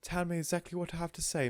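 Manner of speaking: a light worry; worried Mrs Silver. A narrator's reading.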